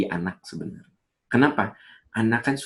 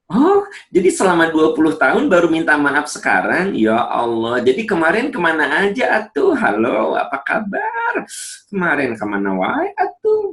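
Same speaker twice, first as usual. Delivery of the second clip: shrill mocking high-pitched retort, much higher and louder; mock the husband's late apology with scorn; exaggerated sarcastic wife mimicking disbelief; 9 semitones higher, 5 dB louder than usual. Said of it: "kata istri".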